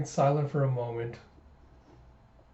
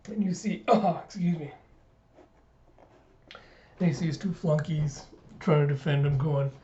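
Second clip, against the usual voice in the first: ordinary delivery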